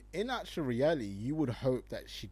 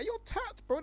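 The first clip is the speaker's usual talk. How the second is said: incredulous. Real, not acted. Calling the guy crazy.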